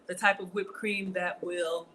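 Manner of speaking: ordinary delivery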